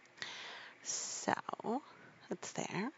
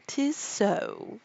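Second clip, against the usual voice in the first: Duchess voice; 4 semitones higher, 4 dB louder than usual. A narrator's reading.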